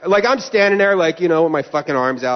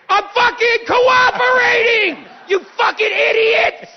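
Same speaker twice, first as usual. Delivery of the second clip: full-blown high, furious scream; re-enacted meltdown, screamed at the top; slow screamed protest ending in an insult; screaming outburst; 4 dB louder, slower than usual. Retold blow-up at screeners.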